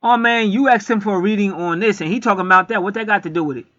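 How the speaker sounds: high, loud scoffing disbelief; mock incredulous complaint. Skeptic viewer scoffing at the reading, high and loud. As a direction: scoff like a sceptic who thinks the reading is off. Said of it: "'Cause somebody could say".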